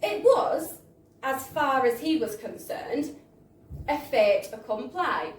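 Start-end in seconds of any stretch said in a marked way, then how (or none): none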